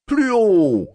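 crié plus haut et plus fort, ton qui tombe sur «Plus haut»; le maître crie son ordre à l'élève; cri du maître. A narrator's reading. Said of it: "cria le maître". (le maître crie à l'élève d'une voix plus haute et plus forte)

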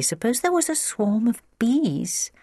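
put-on high child's musing; thoughtful. A narrator's reading. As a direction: wonder aloud in a child's high voice. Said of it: "he added thoughtfully".

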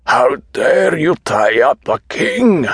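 slow, high-pitched outraged shout; hoarse shout. A narrator's reading. Restrained patient protesting being tied up, hoarse shout. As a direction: shout it slowly, like an affronted king. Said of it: "he shouted hoarsely".

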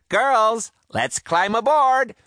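high, loud pirate captain's call; yelled order. Acted. Woman pirate captain yelling to her crew to board. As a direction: yell it to your crew across the deck. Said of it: "a woman pirate captain yelled".

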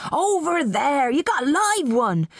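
high, slow-stretched local man's call. A narrator's reading. Broad man pointing out a bidder, calling out. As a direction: call high across the room. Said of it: "pointed in Oliver's direction".

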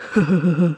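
short dopey chuckle; brief, even huh-huh laugh. A narrator's reading. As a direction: chuckle lazily in a short run. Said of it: "chuckled Dude".

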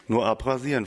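ordinary delivery, nothing marked

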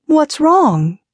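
high-pitched, wary call; suspicious call. A narrator's reading. Patience calls to her halted friend, suspiciously. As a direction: call out, suspecting a trick. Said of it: "Patience called suspiciously".

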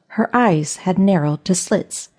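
ordinary delivery

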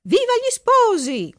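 high, drawn-out cheer; joyful shout. A narrator's reading. Giulia's joyful parents cheer the couple, shouting. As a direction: cheer the newlyweds, high and slow. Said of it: "gridano forte".

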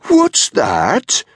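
high, mock-loud question; pointedly loud, pitched right up; exaggeratedly loud. A narrator's reading. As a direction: call it out with exaggerated volume. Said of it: "said in an exaggeratedly loud voice".

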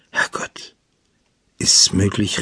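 hushed, understated shock; startled. A narrator's reading. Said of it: "rief sie erschrocken".

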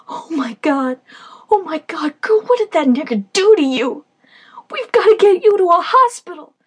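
high, loud horrified scream; screaming in horror. Acted. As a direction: shriek at her battered face. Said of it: "I screamed in horror".

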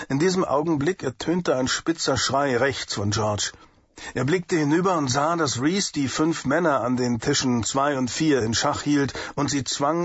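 ordinary delivery

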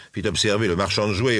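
ordinary delivery, nothing marked

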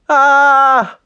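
put-on high, loud little-kid scream; child's frightened shriek. A narrator's reading. Neighbours' small son spotting Gordon watching, high childish shriek. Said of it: "chilló con temblorosa voz infantil".

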